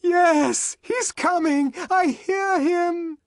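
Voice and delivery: very high, fervent cries; high-pitched frenzied affirmation; excited shout. A narrator's reading. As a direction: cry out each phrase in rapture. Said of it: "shouted Sarojini Engels".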